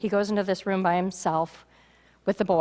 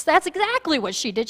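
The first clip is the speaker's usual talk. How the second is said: ordinary talk, nothing marked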